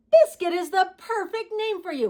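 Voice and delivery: put-on high, loud storybook child; delighted. Little girl to newly named puppy, high delighted voice. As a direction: gush to the puppy.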